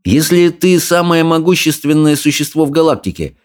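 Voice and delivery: put-on curious boy. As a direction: ask it with curious doubt. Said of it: "поинтересовался Икинг". (любопытный мальчик)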